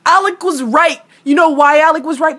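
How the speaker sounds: yelling in exasperation; frustrated shout. Real, not acted. Ranting at dumb characters on TV, frustrated shouting. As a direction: shout it at the screen, fed up.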